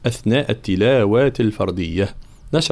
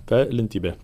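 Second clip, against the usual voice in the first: ordinary delivery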